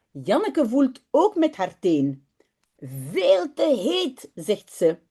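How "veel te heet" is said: put-on little girl voice. Girl tests bath water.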